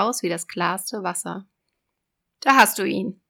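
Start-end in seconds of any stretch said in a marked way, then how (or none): 2.4-3.2 loud, raised sea witch. Acted. Witch voice in fairy tale.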